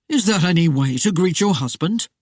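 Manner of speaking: a touch of mock reproach; mock chiding. The sheriff mocks the lady's greeting, chiding. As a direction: tease her like a scolding uncle. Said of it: "Parker chided".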